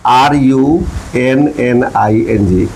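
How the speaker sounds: slow letter-by-letter spelling. Shown as a demonstration. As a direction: spell it out slowly for learners. Spells the word for learners. (धीरे-धीरे एक-एक अक्षर बोलते हुए)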